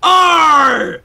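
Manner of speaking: loud, high, drawn-out pirate arr. Pretend. Host signing off the show on request, hammed-up pirate voice. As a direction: ham up a pirate's roar. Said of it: "in your best pirate voice".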